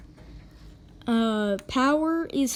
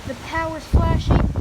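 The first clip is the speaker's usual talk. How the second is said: raised, urgent storm warning; urgent alarm; 3 semitones higher than usual. Real, not acted. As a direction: warn viewers to take shelter now. Debris flying, urging shelter.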